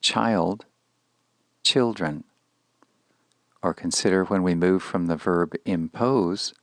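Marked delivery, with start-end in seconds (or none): 0.0-2.2 put-on child with a long i, children with a short i, said slowly; vowel contrast demo. Shown as a demonstration. Teacher showing a class the vowel change, slow and clear. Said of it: "Notice the change in the first vowel".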